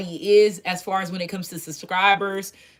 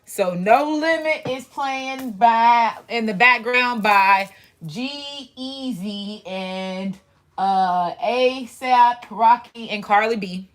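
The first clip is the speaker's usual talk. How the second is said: slowly sounding out names, halting; slow halting reading; much slower than usual. Real, not acted. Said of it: "I sound like an old folks trying to".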